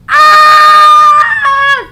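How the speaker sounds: long, very loud high scream. A narrator's reading. Boy jumping off a cliff, long loud scream. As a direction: yell falling off the cliff. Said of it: "screamed Arthur".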